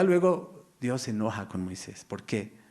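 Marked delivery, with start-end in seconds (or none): none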